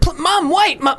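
high sudden call to Mum. Real, not acted. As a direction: call after her before she goes. Calling out to mum.

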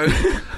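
high, breathy burst of laughter. Real, not acted. Host amused by guest's offended reply, laughter.

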